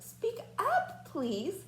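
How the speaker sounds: calling out. Acted. Horton calls down to the speck, high and straining. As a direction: call down to the tiny speck. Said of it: "said Horton".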